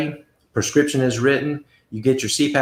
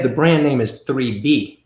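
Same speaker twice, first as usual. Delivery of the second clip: usual speaking voice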